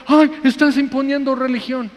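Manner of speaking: high-pitched mocking complaint; mock offended objector. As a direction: imitate a touchy adult protesting. Mimics offended adult objecting to preaching. (queja aguda, ofendida, en burla)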